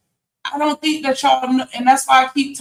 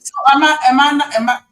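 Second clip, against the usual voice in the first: usual speaking voice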